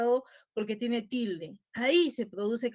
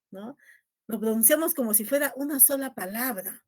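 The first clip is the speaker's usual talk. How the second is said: ordinary talk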